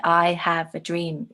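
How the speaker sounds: slow, I stressed, dream falling; slow, weighty speech quote; slow for gravitas. Shown as a demonstration. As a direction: say it slowly for weight. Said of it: "if you talk slowly".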